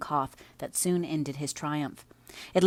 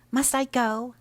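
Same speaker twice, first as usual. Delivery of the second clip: doleful; 9 semitones higher, 5 dB louder, slower than usual. Acted. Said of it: "asked Catherine dolefully".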